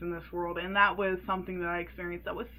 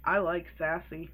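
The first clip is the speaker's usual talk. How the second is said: low, deadpan creep; creepy tone; 5 semitones lower than usual. Retold or imitated. Mechanic commenting on a sassy customer, low and creepy. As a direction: drop it low like a creepy admirer. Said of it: "in, like, a very creepy tone".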